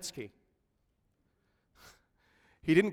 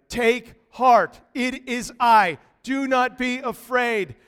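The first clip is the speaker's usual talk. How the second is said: louder and higher, slower congregational call; all together, louder; 5 semitones higher, 7 dB louder, slower than usual. Shown as a demonstration. Preacher leads the congregation in unison, louder. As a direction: lead everyone to say it strong. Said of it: "All together".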